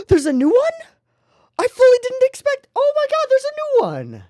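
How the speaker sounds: thrilled squeal at the new song; gasping excitement. Real, not acted. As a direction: gasp with delight at the surprise. Gasps at surprise new song.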